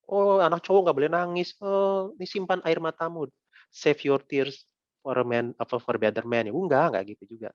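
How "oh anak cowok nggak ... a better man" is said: a hint of a nagging parent, teasing; mock scolding adult. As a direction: ham up a preachy elder telling a boy to hold his tears. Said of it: "Saya nggak akan bilang".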